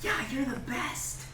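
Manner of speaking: put-on very high, drawn-out adoring child voice; goblin kids. Goblin kids thanking the bear, high adoring voice. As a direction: gush like a tiny fan. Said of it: "And the goblins are like".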